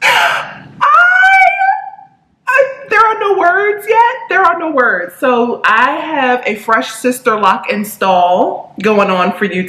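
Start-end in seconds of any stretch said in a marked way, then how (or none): 0.0-5.1 very high, squealed dramatic disbelief; hammed-up, near-tearful squeal easing down; mock overwhelmed. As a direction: act speechless with joy, voice pitched way up. Hammed-up speechlessness over new hair.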